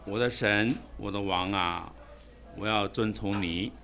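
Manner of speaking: ordinary talk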